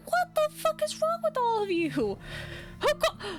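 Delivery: high, incredulous outburst at the judgmental crowd; mock outraged yell. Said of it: "I'm like".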